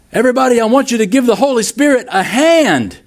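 high, fast, fired-up preacher voice; flamboyant evangelist. Retold or imitated. Evangelist rousing the Russian congregation, high and fast. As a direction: hype the crowd like a showy revival preacher. Enthusiastic evangelist quoted in story.

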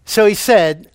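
usual speaking voice

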